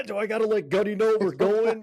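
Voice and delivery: high-pitched imitation of a reluctant Marine; lightly hammed-up wavering; mock dithering. Mimics Marine weighing the perimeter rule.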